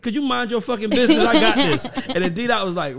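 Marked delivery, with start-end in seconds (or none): 0.0-1.8 put-on arrogant young self. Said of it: "I was a little arrogant".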